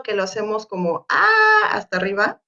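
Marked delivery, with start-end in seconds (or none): 1.1-1.7 "ah" sung out high and level, louder; tono agudo. Shown as a demonstration. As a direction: hold "ah" on a high steady pitch. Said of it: "un tono que es agudo". ("ah" en tono alto y sostenido)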